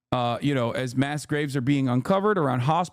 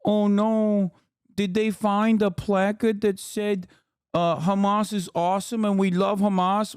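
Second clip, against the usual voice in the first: mock fretting liberal; 3 semitones higher than usual. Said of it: "squishy libs who constantly go".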